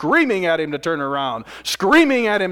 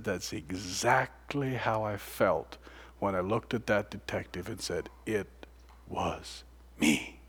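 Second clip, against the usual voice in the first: softer and lower, slow and hushed; hushed confession; 7 semitones lower, 10 dB quieter, slower than usual. Retold or imitated. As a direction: let it sink to a quiet, low admission.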